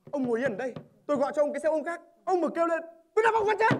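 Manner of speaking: loud threat building to a high shout; menacing threat. Acted. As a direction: threaten him, rising to a yell.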